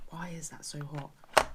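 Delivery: slow whispered question. Real, not acted. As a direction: whisper it, puzzled by the heat. Reacting to hot pot.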